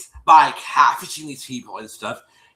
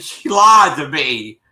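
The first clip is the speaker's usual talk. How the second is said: slow, louder "She lied to me"; retold loud accusation; 10 dB louder, slower than usual. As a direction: spell out the betrayal loudly and slowly.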